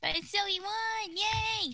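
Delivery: excited high victory cheer. Real, not acted. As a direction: cheer high and bright for the win. Celebrating the win.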